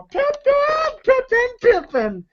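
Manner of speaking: very high-pitched drawn-out squeal; mock pimp cry. Money Mike crowing while he squeezes the pliers, high mock cry. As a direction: call it out way up high.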